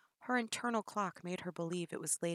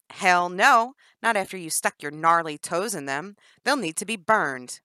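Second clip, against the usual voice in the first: loud scornful no; disgusted refusal; 2 semitones higher, 13 dB louder than usual. Acted. Jennifer rejecting the shoes, loud disgust.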